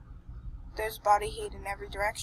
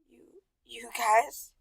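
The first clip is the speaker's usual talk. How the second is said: slow, choked-up whisper; 2 semitones higher, much slower than usual. Acted. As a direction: force the words past tears. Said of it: "I choked out".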